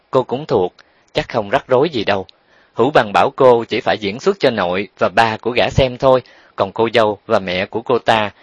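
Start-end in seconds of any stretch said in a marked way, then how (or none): none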